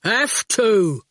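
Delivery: slow, heavy, drawn-out words; terse and stubborn, stretching each word; blunt digger driver. A narrator's reading. Erskine's character voice.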